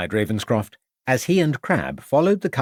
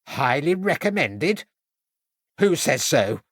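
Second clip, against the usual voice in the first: 1 suspicious; 6 semitones higher than usual. A narrator's reading. Suspicious landlady.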